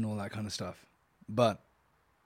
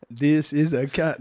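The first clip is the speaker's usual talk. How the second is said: slow, raised mock classroom reading; schoolroom sentence drill; 4 dB louder, slower than usual. Shown as a demonstration. Mocking co-host's sentences as if at school, slow schoolroom drill. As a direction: spell it out slowly like a first reader. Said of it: "teach you how to put sentences together".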